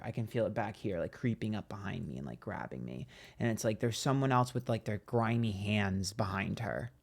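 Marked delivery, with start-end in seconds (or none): 0.0-3.1 softer and lower, fading to a hush. Real, not acted. Reader sensing a presence behind her, fading softer and lower. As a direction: let the voice sink as it creeps closer.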